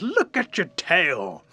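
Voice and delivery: slow, high, loud exclamation; astonished exclamation. Acted. Everybody sees the raccoon's ringed tail, acted astonishment. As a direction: stretch it out in acted amazement.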